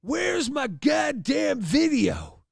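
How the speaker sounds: slow, high yelled dad voice; hammed-up raging dad shouting; furious shout. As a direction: bellow at the teen center staff. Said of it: "My dad's pissed".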